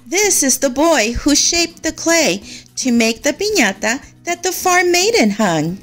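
usual speaking voice

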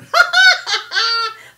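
high, loud cheeky laugh; loud cackle. Real, not acted. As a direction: burst into a naughty cackle. Bawdy laugh about sex.

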